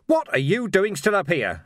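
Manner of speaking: roaring at her. Acted. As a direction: bellow over the din of battle. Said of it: "he roared".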